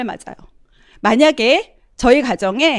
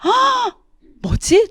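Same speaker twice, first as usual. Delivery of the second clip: high startled gasp, then a puzzled question; 11 semitones higher, much slower than usual. Retold or imitated. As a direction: act out being taken aback. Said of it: "저는 너무 놀랐어요". (깜짝 놀라 숨을 들이켜며)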